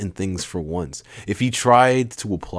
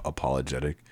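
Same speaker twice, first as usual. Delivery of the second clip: usual speaking voice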